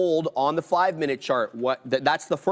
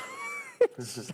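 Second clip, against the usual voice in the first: high burst of laughter; 8 dB quieter than usual. Real, not acted.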